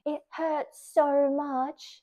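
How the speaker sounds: high, very slow pained stress; drawn-out emphasis. Real, not acted. Recalling the injection, slow pained emphasis. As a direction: stretch it out to show the pain.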